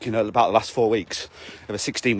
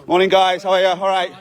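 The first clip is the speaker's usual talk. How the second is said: cheery greeting; 6 semitones higher, 4 dB louder than usual. Real, not acted. Greeting the dog on walk.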